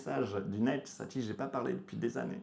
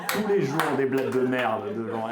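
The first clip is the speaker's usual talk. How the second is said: ordinary talk, nothing marked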